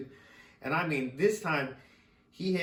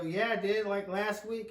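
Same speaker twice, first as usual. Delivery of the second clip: put-on cocky teen answer; 7 semitones higher than usual. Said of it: "I go".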